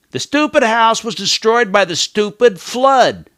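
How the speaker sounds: high, loud and annoyed; irritated. Shown as a demonstration. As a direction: spit out each stupid in irritation. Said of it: "I'm getting irritated by these filler words".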